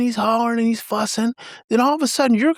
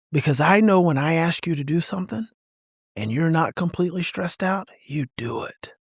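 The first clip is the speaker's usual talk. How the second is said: ordinary talk, nothing marked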